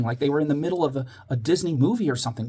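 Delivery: plain reading voice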